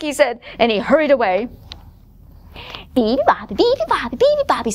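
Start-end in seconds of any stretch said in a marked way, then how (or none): 3.0-4.7 put-on high chirpy baby bird chant; cheerful. Baby bird before his morning nap, cheerful chirping. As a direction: chirp it merrily, climbing higher. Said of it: "said the baby Beeby bird cheerfully".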